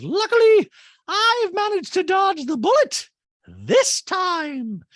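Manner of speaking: loud, slow, very high falsetto; Dorit. Acted. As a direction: proclaim it theatrically, way up high. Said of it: "Dorit in a talking head goes".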